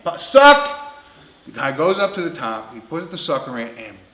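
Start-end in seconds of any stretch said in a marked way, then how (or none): 0.0-0.9 high, loud command with a rise; barked order. Retold or imitated. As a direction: yell the order across the table. Surgeon ordering suction, acted.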